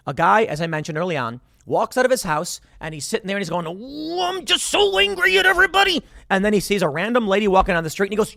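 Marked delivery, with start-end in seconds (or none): 3.7-6.0 much higher, hammed-up angry guy; mock angry man. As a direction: whine it like a cartoon grump. Absurd angry man voiced.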